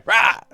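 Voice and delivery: very loud, high "rah" yell; distracting shout. Game master demonstrating Kia's failed distraction, loud wordless shout. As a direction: burst out with a wordless roar. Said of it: "Kia's shout".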